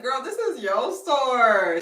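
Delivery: high, loud and slow sass; sassy. Retold or imitated. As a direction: spell it out to her. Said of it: "I was like".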